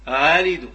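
آلد loud, second hamza halfway to alif; half-formed second hamza gliding in آلد; eased second hamza. Shown as a demonstration. Teacher repeating the tashil model, آلد with second hamza eased. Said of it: "واش تسمعوها همزة خالصة؟".

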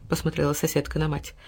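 usual speaking voice

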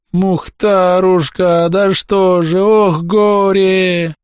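high, long drawn-out keening; keen in grief, stretching every word; wailing aloud. A narrator's reading. Old woman sees her dying dog, wailing aloud. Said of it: "завыла в голос".

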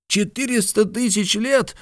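very high-pitched amazed exclamation; high, incredulous outburst; astonished cry. A narrator's reading. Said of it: "вскричал Ардан".

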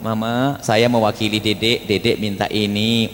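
father as go-between. Acted. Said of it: "abah saja yang ngomong".

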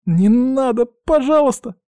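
very high, pleading squeal; squeak. Acted. Man on the mast about to be shot at, terrified squeak. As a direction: squeak it out in fear. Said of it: "пискнул Горенц".

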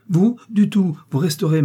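high, loud, indignant protest; emphatic refusal. A narrator's reading. Daughter refusing to let her mother leave, emphatic. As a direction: forbid it with a burst of force.

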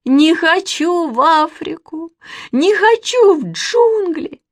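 slow, high, loud wailing; drawn-out tearful refusal; bawling. A narrator's reading. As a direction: bawl in protest like a little girl. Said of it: "ревела Манечка".

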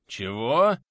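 loud, rising, astonished what; startled surprise. A narrator's reading. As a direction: jump at the unexpected news. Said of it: "аж подпрыгнул от неожиданности собеседник".

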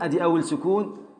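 ordinary talk

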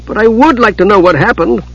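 high, insistent little voice; inquisitive kender. A narrator's reading. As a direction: let it pop out, high and curious. Irrepressible kender asks despite rebuke.

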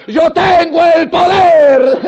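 slow, high, loud bellow; triumphant shout. Retold or imitated. Thief caught by inspectors yells to cover himself, slow booming shout. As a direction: shout it slowly like a proud superhero. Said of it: "para disimular el tucumano gritaba".

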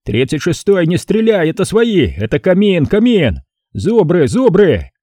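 high, loud urgent radio shout; shouting over the radio. Retold or imitated. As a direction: yell it into the radio handset. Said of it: "кричит в эфир".

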